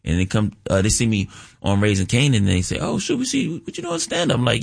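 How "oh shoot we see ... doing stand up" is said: high-pitched mimicry of surprised fans. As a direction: voice an excited fan, pitched way up. Acting out fans' reaction.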